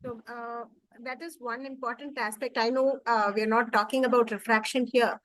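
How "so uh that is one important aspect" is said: very faint speech; faint, hard to hear; barely audible. Real, not acted. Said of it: "can you hear me well?".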